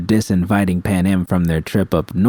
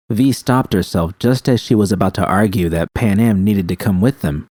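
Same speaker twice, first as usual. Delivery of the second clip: plain reading voice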